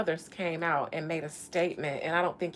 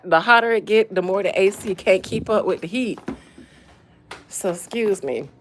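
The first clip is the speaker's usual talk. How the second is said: normal talking voice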